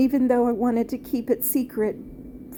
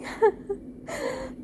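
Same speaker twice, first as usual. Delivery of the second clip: high airy sob-laugh; real tears breaking through; crying laugh; 12 semitones higher, 4 dB quieter than usual. Real, not acted. Sob-laugh mid sentence.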